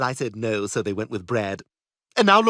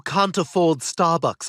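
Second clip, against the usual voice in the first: slow mock slogan; slower than usual.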